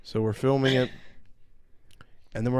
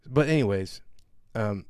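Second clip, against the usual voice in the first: ordinary delivery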